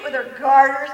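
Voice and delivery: ordinary talk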